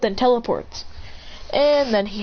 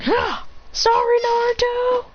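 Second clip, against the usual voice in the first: frantic apology. Acted. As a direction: cry out sorry mid-action. Shooting his friend down.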